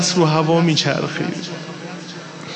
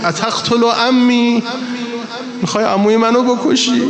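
put-on child's defiant cry; 7 semitones higher, 3 dB louder than usual. The boy crying out at the swordsman over his uncle, defiant. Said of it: "گفت".

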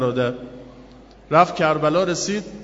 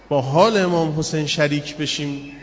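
ordinary talk, nothing marked

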